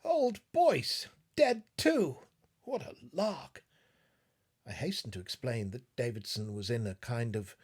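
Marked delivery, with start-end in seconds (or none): 0.0-3.6 high, loud excited outburst; a giddy exclamation; exclaimed. A narrator's reading. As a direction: blurt it out with dazed delight. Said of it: "Davidson exclaimed".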